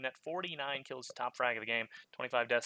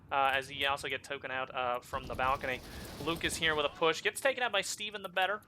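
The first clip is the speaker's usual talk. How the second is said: ordinary delivery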